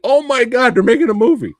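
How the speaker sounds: high, loud fan squeal; excited fan. Retold or imitated. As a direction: gush like a fan hearing big news. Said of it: "I was like".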